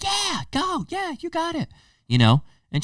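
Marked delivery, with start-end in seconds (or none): 0.0-1.7 cheering son. Retold or imitated. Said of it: "he's just like".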